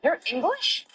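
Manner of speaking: high, rising disbelief; surprised fan. Retold or imitated. Fan hearing the actor's accent, high surprised imitation. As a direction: imitate a fan's astonished question.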